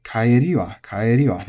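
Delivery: Kaeri wa with the pitch peak on ri; accent on ri. Shown as a demonstration. Teacher modelling Kaeri wa with accent on ri. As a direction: stress the ri in Kaeri wa. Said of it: "goes onto the ri".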